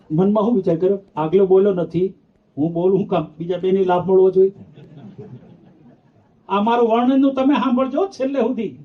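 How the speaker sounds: normal talking voice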